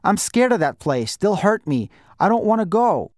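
put-on high, scared child's plea; frightened pleading. A narrator's reading. Scared boy begs his guardian not to send him away, frightened. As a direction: plead fearfully, pitch climbing to the end.

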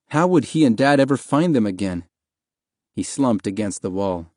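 ordinary delivery

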